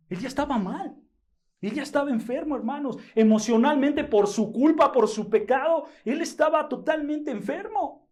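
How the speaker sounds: high, loud, fervent preaching; preaching at full force. Acted. Pastor telling brothers David was sick, full-force preaching.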